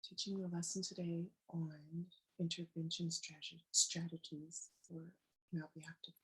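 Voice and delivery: faint, hoarse near-whisper; a voice almost gone, barely voiced; losing voice. Real, not acted. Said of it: "excuse my voice, I'm losing it".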